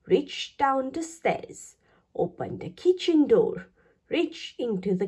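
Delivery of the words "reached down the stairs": plain reading voice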